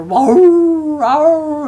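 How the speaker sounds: high yelping bay, like a hound; imitating a baying coon dog; mock dog baying. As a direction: howl it like a treed hound.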